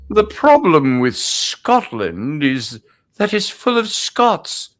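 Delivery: slow, swooping villain-king quote, rising high at the end; Edward Longshanks. Acted. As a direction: drawl it out like a theatrical movie king. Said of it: "there is a quote of his".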